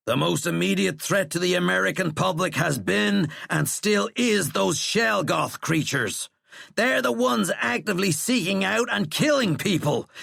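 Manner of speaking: high, forceful shouted argument; angry tirade. A narrator's reading. General berating the president for not listening, angry tirade.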